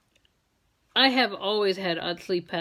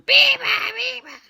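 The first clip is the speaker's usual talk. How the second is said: high chirpy bird call; high mimicked birdsong; bird call imitation; 6 dB louder, slower than usual. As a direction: sing it like a little bird. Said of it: "all of a sudden".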